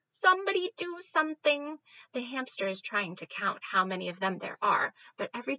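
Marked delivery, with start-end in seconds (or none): 0.1-1.8 high, loud panicked squeak; squeaking rat. Acted. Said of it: "The rat squeaks".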